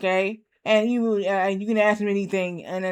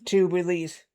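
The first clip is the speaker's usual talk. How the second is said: ordinary talk, nothing marked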